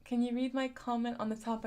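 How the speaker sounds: ordinary talk, nothing marked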